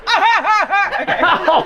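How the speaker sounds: loud, high mock laugh; hammed-up high "ha ha ha"; imitated laugh. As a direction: mimic her laugh, high and punchy. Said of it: "she goes".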